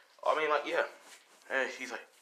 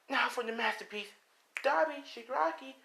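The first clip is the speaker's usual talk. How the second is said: much higher gleeful announcement; gleeful villain girl. Acted. Toga calling Dabi and Shigaraki in, high gleeful voice. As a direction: present the masterpiece with glee.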